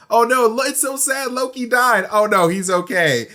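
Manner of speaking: high-pitched mock dismay over a fake death; put-on fretting, pitched well up; mock lament. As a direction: wail "oh no" in pretend sorrow. Mock reaction to Loki's fake death.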